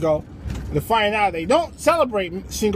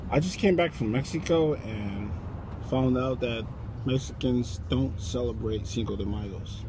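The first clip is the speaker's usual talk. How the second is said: low, hushed confession to the boardroom; subdued, embarrassed announcement; sheepish report; 9 semitones lower, 10 dB quieter than usual. Acted. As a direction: trail it out softly and low. Acted awkward report to executives.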